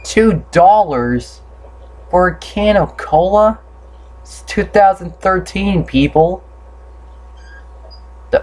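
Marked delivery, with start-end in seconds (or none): 0.1-6.4 higher, indignant disbelief; incredulous. Real, not acted. Gamer balking at a can of cola's price, incredulous.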